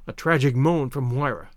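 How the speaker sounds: ordinary delivery, nothing marked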